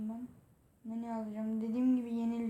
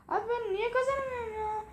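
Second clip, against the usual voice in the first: whiny complaint; 11 semitones higher than usual. Real, not acted. Losing repeatedly.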